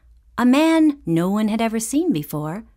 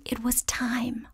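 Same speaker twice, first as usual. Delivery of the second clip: whisper; 3 semitones higher, 10 dB quieter, slower than usual. A narrator's reading. Narrator builds suspense.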